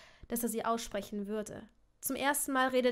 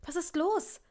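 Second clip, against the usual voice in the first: higher, rising puzzled question; slightly irritated query, pitched up; 6 semitones higher than usual. A narrator's reading. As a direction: ask it with mild bewilderment. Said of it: "fragte Hermine irritiert".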